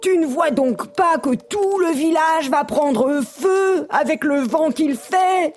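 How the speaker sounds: high, drawn-out panicky warning; agitated little creature; alarmed frog. A narrator's reading. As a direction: warn urgently, high and stretched. Panicked frog warns neighbour.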